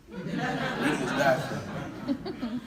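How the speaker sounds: slow, teasing drawl on reading is bad for you; drawn-out slow repeat. Shown as a demonstration. Preacher teases the congregation, slow laughing drawl. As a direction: stretch every word slowly with a laugh in it.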